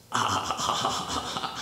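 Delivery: soft, voiceless sniffing breaths; mimed sniffing. Acted. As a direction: mime catching a whiff of smoke. Acts out smelling smoke in street.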